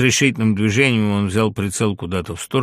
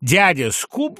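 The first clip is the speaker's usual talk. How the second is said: high, loud shocked question; scandalised raised voice; indignant; 10 semitones higher, 6 dB louder, much slower than usual. A narrator's reading. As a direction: ask it in outraged disbelief. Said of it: "возмутился гусар".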